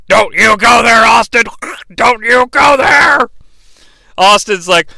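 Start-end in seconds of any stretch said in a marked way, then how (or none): 0.1-1.4 stern warning. Retold or imitated. Vince warning Austin on phone. 1.9-3.3 higher, slow drawn-out yell; angry shout. Retold or imitated. Vince on the phone yelling at Austin, slow angry shout.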